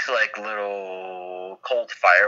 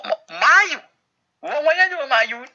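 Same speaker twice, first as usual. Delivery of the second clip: startled high yelp; faster than usual. Acted. Dragged out of the bar.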